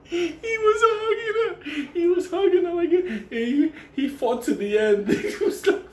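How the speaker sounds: high, squeaky laugh-crying through words; laughing helplessly. Real, not acted. Telling friends about Benny hugging the owner, laughing to tears. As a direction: crack up mid-sentence. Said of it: "laughing while retelling".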